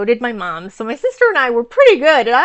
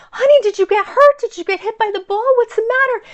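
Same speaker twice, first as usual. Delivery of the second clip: high, concerned motherly voice; worried team mom; 11 semitones higher, 4 dB louder, faster than usual. Retold or imitated. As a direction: ask like a worried mom checking on a child. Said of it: "she's like".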